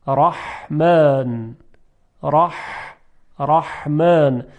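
رحمن slowly, ح squeezed in the throat; constricted Arabic ح. Shown as a demonstration. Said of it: "اما هی جیمی". (تلفظ رحمن با حای گرفته و پرفشار)

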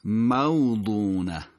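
موضونة slowly as "maw-ḍūū-nah"; heavy ḍād, drawn-out ū in موضونة; careful Quranic pronunciation. Shown as a demonstration. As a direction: recite موضونة with deliberate long vowel. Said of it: "نوع خوندنش حتما دقت بفرمایید".

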